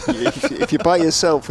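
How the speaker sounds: words bubbling out through real laughter; amused, high, stumbling over a giggle; laughing. Real, not acted. Commentator joking about dressing up without a group, laughing.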